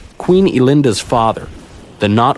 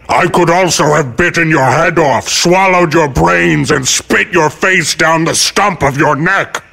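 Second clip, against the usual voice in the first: higher, drawn-out beast threat; slow, forceful monster voice, pitched up; menacing great Yeti; 8 semitones higher, 3 dB louder than usual. Acted. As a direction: threaten like a huge snarling creature.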